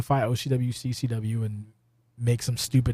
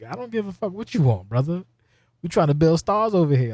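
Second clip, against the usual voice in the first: higher-pitched defiant wrestler retort; mock defiance; 6 semitones higher than usual. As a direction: act out a cocky refusal. Acted wrestler refusing to lose.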